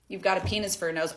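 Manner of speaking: low, fast, quiet inner thought; a stunned private aside, rushed under the breath; baffled inner voice. Retold or imitated. Staring at the woman, thinking in quiet disbelief, low and rushed. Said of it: "I'm looking at her like".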